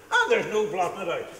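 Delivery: ordinary talk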